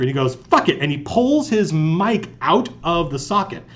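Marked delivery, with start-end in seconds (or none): 0.3-0.8 retold fed-up outburst, pitched high; mock-angry, high-pitched curse. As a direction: imitate someone losing patience. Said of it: "he gets angry".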